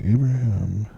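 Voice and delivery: slow, low host announcement; mock-solemn broadcaster, low and measured; podcast voice. Shown as a demonstration. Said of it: "this was my podcast voice".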